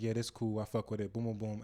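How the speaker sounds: ordinary talk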